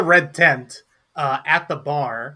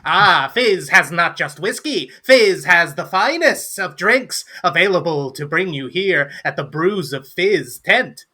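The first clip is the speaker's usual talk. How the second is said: higher, sing-song salesman's boast; showy gnome barkeep; 4 semitones higher, 3 dB louder than usual. Acted. Gnome Fizz advertising drinks in the tent, showy boast. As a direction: pitch your bar with theatrical pride.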